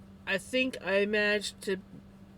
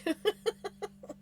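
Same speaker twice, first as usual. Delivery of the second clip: airy, much higher burst of laughter; high breathless laugh; 14 semitones higher than usual. Real, not acted. Laughing at the post about the male kitty, high and breathless. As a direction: laugh helplessly at the mix-up.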